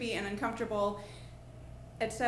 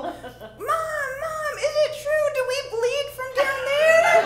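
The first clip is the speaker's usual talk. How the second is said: put-on high, loud child's yell; kids yelling; 11 dB louder, slower than usual. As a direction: holler for mom like an alarmed little kid. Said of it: "yelling the whole way back".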